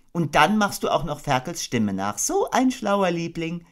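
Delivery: high, gentle mother kangaroo voice; motherly Kanga. Acted. Kanga tucking her baby into bed, motherly. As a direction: coo it like a doting mother.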